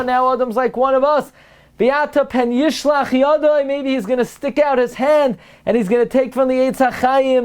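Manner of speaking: high, urgent sermon delivery; dramatic high-pitched retelling from the pulpit; fervent preaching. Acted. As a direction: preach it excitedly, pitched well up.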